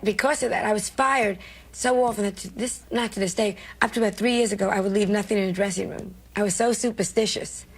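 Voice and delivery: ordinary talk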